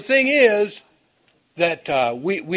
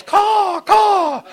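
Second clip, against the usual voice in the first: high, loud cawing crow call; crow caw imitation; 8 dB louder than usual. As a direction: squawk it out like a crow. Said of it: "a crow goes".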